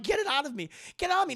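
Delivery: high, loud panicked demand; imitated frantic patient; mock freak-out. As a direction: squeal it in escalating desperation. Acting her begging for removal.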